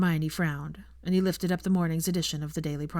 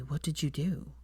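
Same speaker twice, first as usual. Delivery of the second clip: horrified hush; 3 semitones lower, 6 dB quieter than usual. A narrator's reading. Draco horrified, quiet.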